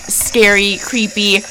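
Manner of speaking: normal talking voice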